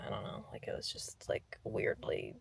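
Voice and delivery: soft unvoiced whisper. Real, not acted. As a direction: drop to a whisper. Quiet aside after workout.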